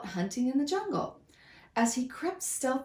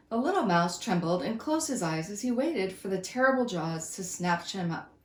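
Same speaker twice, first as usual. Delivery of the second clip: usual speaking voice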